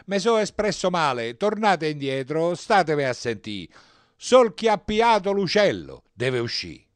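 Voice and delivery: loud, high, insistent announcement; priest calling out. A narrator's reading. Priest calling the congregation back to listen, loudly. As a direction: correct yourself loudly over the congregation. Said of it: "Ma il parroco lasciò il confessionale".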